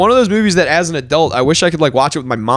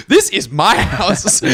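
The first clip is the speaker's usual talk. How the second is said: angry homeowner voice; 4 semitones higher, much slower than usual. Acted. Voicing the angry returning husband.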